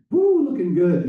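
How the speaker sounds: high, loud cheer as if from a passing truck; yelled out. Retold or imitated. Truck driver yelling to builders at work, yelled out. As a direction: whoop it out to people across the road. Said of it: "this guy yelled out the window".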